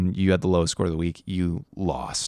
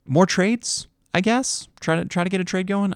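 upbeat urging; 11 semitones higher than usual. Real, not acted. Urging the league to trade.

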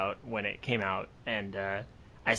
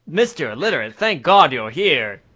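mock dramatic relief; 3 semitones higher, 8 dB louder than usual. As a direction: cry out in staged relief.

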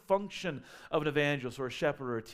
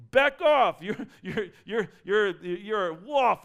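louder and higher on back off, then a slow accusation; protective shepherd warning; 3 semitones higher, 7 dB louder, slower than usual. Retold or imitated. Preacher voicing a shepherd guarding the flock, loud warning. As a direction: warn off a wolf. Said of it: "And they're like".

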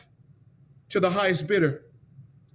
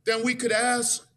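rising sermon; 4 semitones higher than usual. Acted. Preacher telling brothers and sisters they are freed, building to a shout. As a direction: lift the congregation toward praise. Preacher applies story to congregation.

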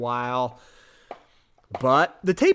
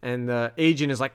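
ordinary talk

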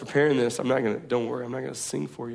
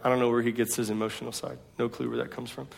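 ordinary delivery

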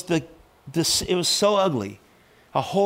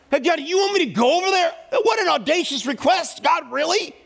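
high-pitched, indignant disbelief; incredulous protest; 13 semitones higher than usual. Retold or imitated. Pastor objecting to God's request, incredulous.

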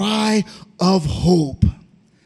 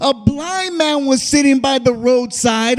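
fervent, raised preaching cadence; preaching at full force; 6 semitones higher than usual. Acted. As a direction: preach it out with a hoarse-free swell. Preacher building fervor.

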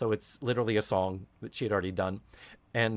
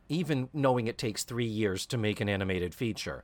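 usual speaking voice

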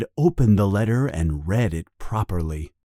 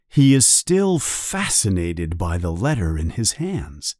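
ordinary delivery, nothing marked

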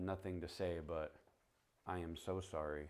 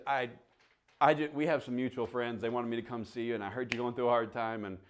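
higher and louder, laughter spilling into the words; laughing retelling; 6 semitones higher, 11 dB louder than usual. Retold or imitated. [name] telling Gail why he came, laughter spilling in. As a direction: explain yourself while trying not to laugh. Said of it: "I almost said it again".

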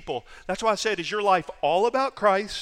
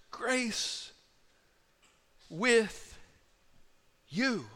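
"Grace", "with", "you" each set apart by long pauses, softer; slow, spaced words; 6 dB quieter, much slower than usual. Shown as a demonstration. Preacher urging listeners not to skim past the words, spaced out. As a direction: let each of the three words land alone. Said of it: "Don't breeze past those three little".

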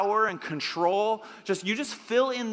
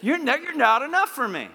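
higher, louder dismissal; a little attitude; 7 semitones higher, 5 dB louder than usual. Shown as a demonstration. Preacher showing the congregation how to reject a car, with attitude. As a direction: reject it with emphatic, playful defiance. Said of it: "say it with a little attitude".

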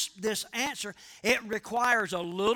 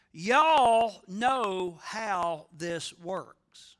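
slow emphasis; 4 semitones lower, much slower than usual. Retold or imitated. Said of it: "Paul was saying".

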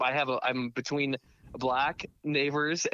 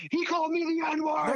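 high, exaggerated accusing cry; hammed-up outraged accusation; mock accusation; 12 semitones higher than usual. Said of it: "but instead he's saying".

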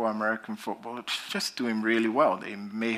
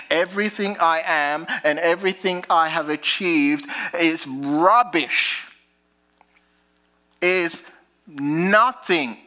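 preaching; 6 semitones higher, 5 dB louder than usual. Acted. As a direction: drive Paul's words home from the pulpit. Preaching Paul's words.

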